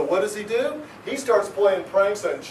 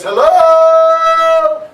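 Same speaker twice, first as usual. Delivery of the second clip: a sudden loud high hello; 8 semitones higher, 12 dB louder than usual. Retold or imitated. A man in the dark calls out a loud hello. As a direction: belt out a cheery greeting. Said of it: "he goes".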